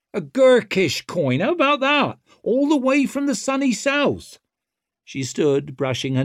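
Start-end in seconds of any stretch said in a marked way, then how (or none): 0.1-4.4 high, cocky and loud; swaggering rogue taunting; brash young woman. A narrator's reading. Ricker voice.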